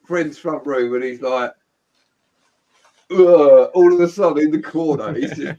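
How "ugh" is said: retold retch. Friend about to throw up.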